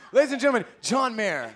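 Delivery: high, loud, laughing announcer. Pretend. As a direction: announce him like a big star. Introducing guest as announcer.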